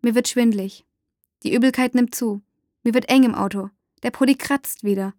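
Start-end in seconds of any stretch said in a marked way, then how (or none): none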